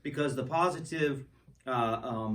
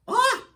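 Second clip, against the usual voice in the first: sudden pained shout, much higher and louder; real sharp yelp of pain; real pained cry; 9 dB louder than usual. Real, not acted. Bites candy, breaks tooth.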